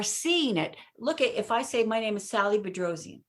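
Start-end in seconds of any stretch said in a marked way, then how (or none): 1.8-3.2 fast, rushed, trailing off; rushed name. Shown as a demonstration. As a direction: rattle "Sally Bedrosian" off in a blur. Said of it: "It's like, "What?".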